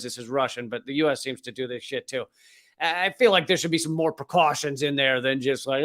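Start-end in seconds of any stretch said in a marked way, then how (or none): none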